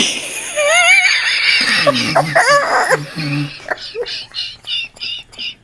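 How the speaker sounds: high breathless giggling fit; wheezing with laughter; helpless laughter. Real, not acted. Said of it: "I can't talk".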